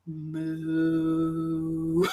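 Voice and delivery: long, drawn-out imitated moo; cow moo. Trying to make a tired crew smile, imitated cow moo. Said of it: "I just started, like, mooing".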